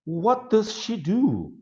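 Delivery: asking voice. Shown as a demonstration. Teacher asking model question.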